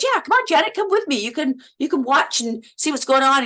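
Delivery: high, bright friendly invite; imitating Ewan. Actor inviting her to watch, in a high friendly voice. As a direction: playfully wave her along. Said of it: "Ewan looks and goes".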